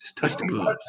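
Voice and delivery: hushed conspiratorial whisper; sly whispered bargain. Retold or imitated. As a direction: whisper it like a secret deal. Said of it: "You like".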